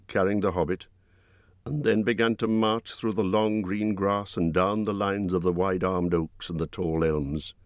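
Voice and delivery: ordinary delivery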